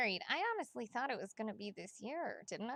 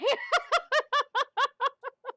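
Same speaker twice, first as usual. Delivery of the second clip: high, loud burst of laughter; loud cackle; 13 semitones higher, 13 dB louder than usual. Real, not acted. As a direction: crack up at your own joke, long and loud. Cracks up at her own joke.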